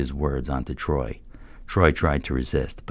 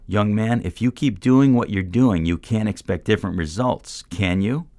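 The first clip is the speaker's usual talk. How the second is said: demanding; 6 semitones higher, 4 dB louder than usual. A narrator's reading. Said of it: "demanding another answer".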